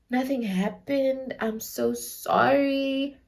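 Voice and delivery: mock-contrite, every word dragged out; imitated apologetic boyfriend. Boyfriend apologising to her mom, soft slow mock-contrite plea. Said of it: "then he was like".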